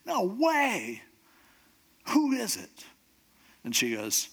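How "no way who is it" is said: high-pitched, incredulous royal outrage; furious king. Acted. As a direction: play a king exploding in disbelief. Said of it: "the king's just furious".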